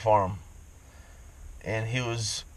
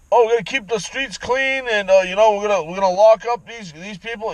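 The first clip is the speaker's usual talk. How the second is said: higher, singsong campaign promise; mocking the sheriff; 6 semitones higher than usual. Ranter mimics the county sheriff's campaign line, higher and mocking. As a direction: parody a smug politician's pledge. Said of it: "all he's gonna say is".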